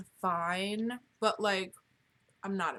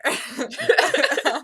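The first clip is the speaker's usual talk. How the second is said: loud laugh; 7 semitones higher, 13 dB louder than usual. Real, not acted. Laugh burst.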